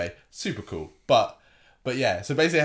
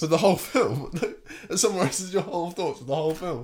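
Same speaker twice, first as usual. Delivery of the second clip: laughing disbelief; 5 semitones higher than usual. Real, not acted. Incredulous laughing question.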